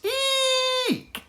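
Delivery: tiny owl's very high squeaky screech; owlet screech. Acted. Baby owl showing off his new screech. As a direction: screech like a baby owl trying to sound grown-up. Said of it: "he screeched".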